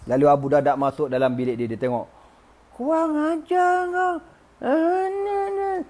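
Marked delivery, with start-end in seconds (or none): 2.7-5.8 very high, drawn-out furious squeal; hammed-up scream of outrage; mock enraged scream. Husband sees his broken idol, mock enraged scream. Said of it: "aku tak nak jerit".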